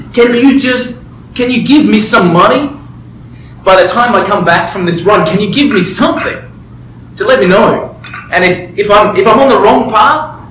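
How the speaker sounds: pleading for a sign, voice lifting with emotion; emotional plea. Retold or imitated. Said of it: "I'm getting emotional".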